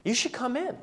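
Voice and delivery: put-on high, light girl's voice; unembarrassed schoolgirl. Girl going to religious club invites friends, high-pitched. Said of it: "the girl who's going in goes".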